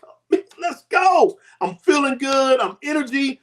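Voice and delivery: high, drawn-out burst of excitement at the start; hyped-up retold celebration; exuberant. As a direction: act out bursting with new energy. Acting out his new energy.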